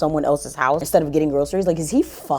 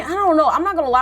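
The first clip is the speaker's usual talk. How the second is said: ordinary talk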